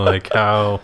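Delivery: ordinary delivery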